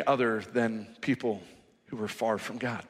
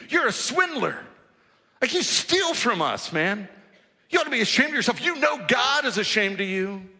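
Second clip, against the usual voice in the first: raised, high-pitched accusing rant; pitched-up mock outrage at the tax collector; indignant crowd; 8 semitones higher than usual. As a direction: scold him like a cheated neighbour. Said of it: "What would you want to say to that guy?".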